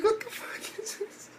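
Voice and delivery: high, hushed incredulous squeak; thin high voice of disbelief; baffled reaction. Retold or imitated. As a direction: squeak out your bewilderment, softly. Retells own baffled reaction, then laughs.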